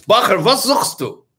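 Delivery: a rabbi calling out to a wandering student, voice lifted; old rabbi questioning. Retold or imitated. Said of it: "He looks at the bacher and says".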